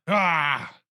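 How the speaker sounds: exasperated cry. Acted.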